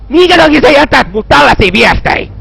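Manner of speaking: high, exasperated wail; distressed protest. Real, not acted. Player upset by creepy messages, high protesting cry. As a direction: cry out the question, pitched high.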